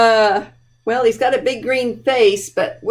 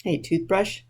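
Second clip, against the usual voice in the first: plain reading voice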